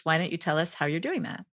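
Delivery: ordinary talk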